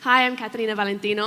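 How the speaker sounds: normal talking voice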